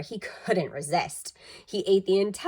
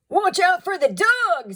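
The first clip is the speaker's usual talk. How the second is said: much higher, louder nervous warning; wary burglar; 12 semitones higher, 7 dB louder than usual. A narrator's reading. Burglar warning the other about the dog. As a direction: warn your partner urgently. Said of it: "said one of the burglars".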